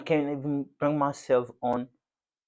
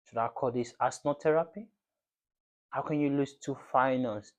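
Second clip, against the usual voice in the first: usual speaking voice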